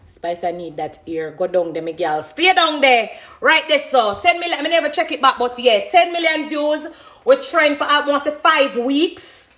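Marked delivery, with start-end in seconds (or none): 2.2-9.5 excited brag. Real, not acted. Excited about ten million views.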